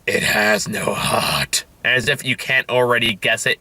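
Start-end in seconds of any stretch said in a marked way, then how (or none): 0.1-1.7 slow, heavy, deadpan robot line; hammed-up brooding robot, very slow; mock robot man. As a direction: drag it out like a gloomy robot. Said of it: "And he just goes like".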